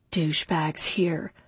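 breathy hushed whisper; whispered thought. A narrator's reading. Sneaking into apartment, inner thought.

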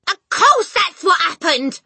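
high, loud, slow outcry; exasperated cry. A narrator's reading. Mother agreeing, exasperated cry. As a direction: cry it out emphatically. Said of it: "the mother cried".

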